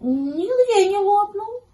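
slow, very high kitten verdict; high-pitched careful kitten; Гав. A narrator's reading. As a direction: draw it out in a piping voice, ending up. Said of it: "Котёнок Гав обошёл вокруг щенка и сказал".

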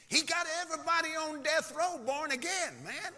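high, rousing preacher delivery; excited preaching. Acted. Preacher tells congregation of death row conversions, high excited preaching. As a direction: preach it with rising force.

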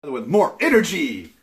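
loud, high and upbeat; energetic. Pretend. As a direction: pump up the energy, almost shouting. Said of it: "Look at me, I have energy".

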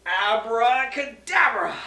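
drawn-out loud Abracadabra; booming magic word. Acted. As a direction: call the spell slowly and loudly.

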